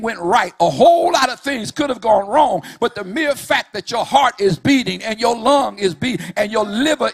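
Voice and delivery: whooping preacher at full force; full-force preaching. Acted. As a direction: preach it hard, riding the music. Preaching climax over music.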